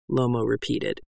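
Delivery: usual speaking voice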